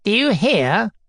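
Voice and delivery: gloating call for attention; triumphant. A narrator's reading. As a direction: crow it to an onlooker. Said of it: "turning to Obnoskin in triumph".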